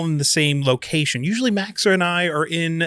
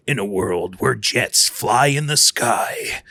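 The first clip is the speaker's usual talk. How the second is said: deep, slow trailer narrator; movie announcer voice; 5 semitones lower, slower than usual. Pretend. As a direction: boom it out like a film trailer. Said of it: "my movie announcer voice".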